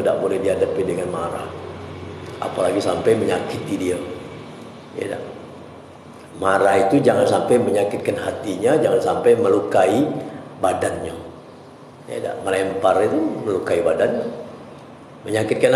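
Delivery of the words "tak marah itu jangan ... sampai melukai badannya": normal talking voice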